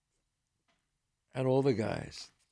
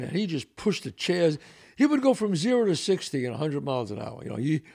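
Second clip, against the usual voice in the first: ordinary talk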